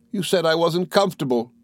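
high, hurt accusation; aggrieved cry. A narrator's reading. Dora accusing her husband, crying out aggrieved. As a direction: protest like a wounded young wife. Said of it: "cried Dora".

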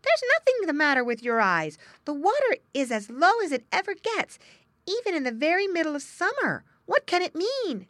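put-on high young otter full of wonder; Little Joe amazed.

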